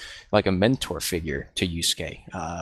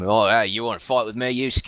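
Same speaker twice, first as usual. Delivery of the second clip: lightly Australian brawler, a touch higher; cheeky Australian drunkard challenge; Australian accent; 4 semitones higher, 4 dB louder than usual. Retold or imitated. Podcaster imitating the dub's Australian Chu, calling Yusuke out. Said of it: "giving him an Australian accent like that".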